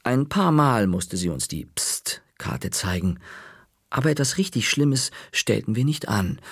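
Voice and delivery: usual speaking voice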